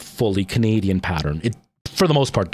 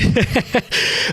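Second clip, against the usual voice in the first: high, breathy real giggle; laughing; 12 semitones higher than usual. Real, not acted. Streamer tickled by chat, real high giggle. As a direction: giggle high at your own remark.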